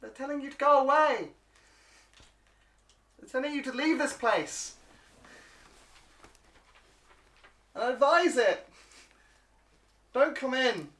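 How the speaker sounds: high, squeaky little kobold warning. Acted. GM as kobold guard warning the party off: high squeaky voice. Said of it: "kind of saying".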